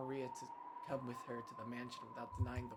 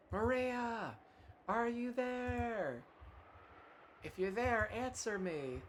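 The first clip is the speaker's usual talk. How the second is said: calling out; 10 semitones higher, much slower than usual. Acted. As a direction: shout her name across the storm, slowly. Mother calling lost daughter in storm.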